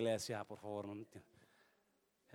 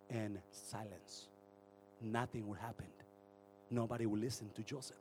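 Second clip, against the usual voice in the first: hushed narration; 6 semitones lower, 6 dB quieter than usual. Acted. Silencio tras gritos.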